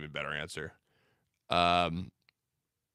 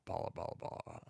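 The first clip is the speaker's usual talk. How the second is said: unvoiced whisper of Bala, Bala, fading to nothing; whispered name; 4 semitones lower, 16 dB quieter, faster than usual. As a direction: let the name die away in a whisper. Repeating the name, fading away.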